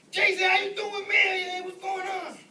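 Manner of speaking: high, loud Jersey greeting; Jersey accent. Retold or imitated. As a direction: call out to an old buddy in a hammed-up Jersey accent. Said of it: "I get these Jersey accents".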